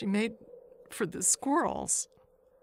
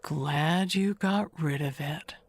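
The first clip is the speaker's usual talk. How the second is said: ordinary delivery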